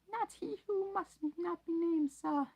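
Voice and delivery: very high squeaky elf voice, loud; high elf voice, hoarse and urgent; hoarse house-elf. Acted. Dobby's voice.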